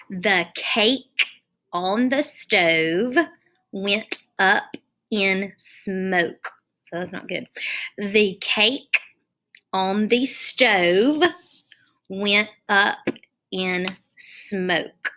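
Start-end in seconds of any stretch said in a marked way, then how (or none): 0.1-6.5 slow read-aloud. Shown as a demonstration. Teacher reads second sentence slowly. 8.0-15.1 slow read-aloud. Shown as a demonstration. Teacher rereads the sentence.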